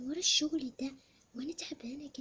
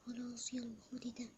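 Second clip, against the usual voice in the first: ordinary talk, nothing marked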